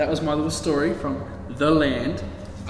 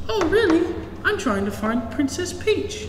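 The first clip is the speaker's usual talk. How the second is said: much higher, bright questioning princess voice; eager princess; 4 dB louder than usual. Acted. Aurora answering Og the protector, high and eager. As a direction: perk up, high and hopeful. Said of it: "She says".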